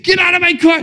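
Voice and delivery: high, loud shouted order; imitated angry yell. Man pulling the girl from his car, imitated angry shout. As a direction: mimic a man yelling her out. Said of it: "He's like".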